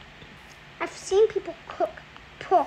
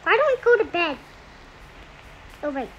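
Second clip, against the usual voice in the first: usual speaking voice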